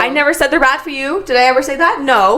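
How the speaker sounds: loud, heated objection. Real, not acted. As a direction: push back loudly in argument. Heated mock argument.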